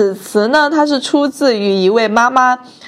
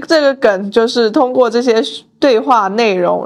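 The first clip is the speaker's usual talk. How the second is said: ordinary talk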